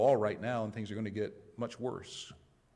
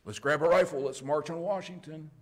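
higher, lightly hammed-up call to arms; mock rallying cry; 6 semitones higher, 6 dB louder than usual. Angry citizen urging others toward Washington, mock rallying cry. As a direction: rouse them in mock outrage.

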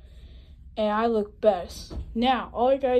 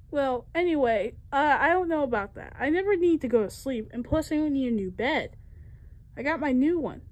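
ordinary delivery

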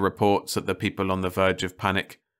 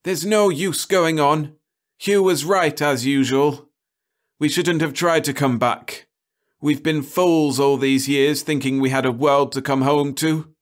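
high bitter lament; despairing cry; 8 semitones higher, 6 dB louder than usual. A narrator's reading. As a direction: plead that it's all over. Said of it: "Cahill cried".

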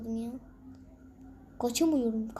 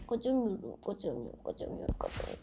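from lower to a whispered murmur; fading despair; 4 semitones lower, 7 dB quieter, faster than usual. Real, not acted. Gamer stuck in a level, fading to a defeated whisper. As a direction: let it die away to a whisper.

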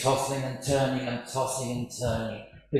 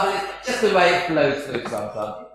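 ordinary talk

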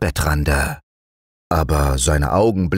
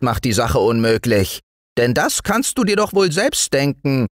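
high, brisk gnome reprimand; self-important tiny woodland man; lecturing little dwarf; 10 semitones higher than usual. Acted. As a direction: lecture the boy in a piping voice. Dwarf figure lecturing the naughty boy.

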